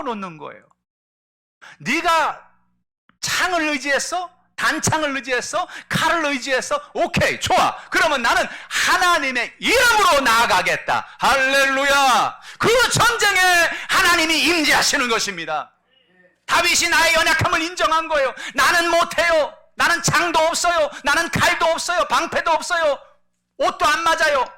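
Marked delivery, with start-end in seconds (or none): 1.8-11.1 higher, louder shouted challenge; a fearless, shouted taunt; defiant shout. Acted. David defies Goliath. 11.2-18.3 high, loud preaching cry; a pastor's loud, soaring exhortation; full-force preaching. Acted. 18.5-24.5 higher, louder admission of weakness; David's loud, earnest confession. Acted.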